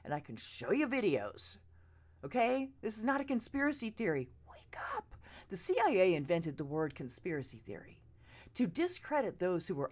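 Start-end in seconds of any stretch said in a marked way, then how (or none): 4.5-5.1 sharp whispered plea; urgent whisper. Real, not acted. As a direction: hiss it urgently at the listener. Urging viewers to wake up.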